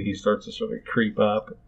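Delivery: ordinary talk, nothing marked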